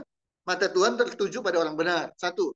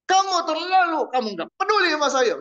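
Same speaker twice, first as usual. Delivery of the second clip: higher, louder, fast quarrel yell; mock angry shout; 11 semitones higher, 7 dB louder than usual. Preacher acting out a spouse yelling in a fight, loud and fast. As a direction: hurl the complaint at the spouse. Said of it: "teriak sampai tetangga pun dengar".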